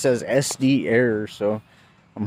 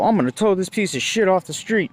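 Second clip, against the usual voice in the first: higher-pitched mock threat; retold yelling cop; 8 semitones higher than usual. Cop threatening to tow a vehicle, mildly heated imitation. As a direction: imitate an officer throwing his weight around. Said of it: "screaming at me like I was his kid".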